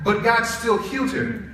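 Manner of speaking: ordinary talk, nothing marked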